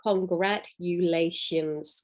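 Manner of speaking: congratulations drawn out slowly; con-gra-tu-la-tions, slow; slow syllables. Shown as a demonstration. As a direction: stretch congratulations beat by beat. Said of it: "a word with five syllables".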